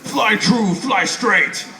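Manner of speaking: rally the reindeer like an action hero; Gerard Butler Santa. Pretend. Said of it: "who's voiced by Gerard Butler".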